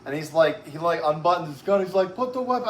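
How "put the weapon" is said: mock cop command, pitched higher; clipped police demand, hammed up a little; stern cop order. Cop with his gun unbuttoned ordering him, higher mock command.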